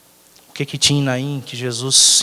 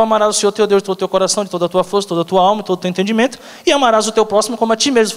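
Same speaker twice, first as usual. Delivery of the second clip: scripture recitation; 6 semitones higher, much faster than usual. Acted.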